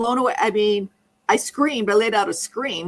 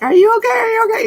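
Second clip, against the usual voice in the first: very high, loud teasing imitation; mock worried husband; 6 dB louder, faster than usual. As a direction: squeak the concern like a fussy husband. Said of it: "my star husband was like".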